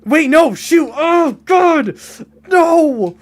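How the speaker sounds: high, loud mock wail; hammed-up anguished cry; mock despair. Real, not acted. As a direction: caught out, wailing in mock horror. Mock despair at being caught.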